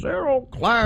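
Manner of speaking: put-on high, creaky grumbling old man. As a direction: grumble it like a cranky grandpa. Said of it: "let me say that in my old man voice".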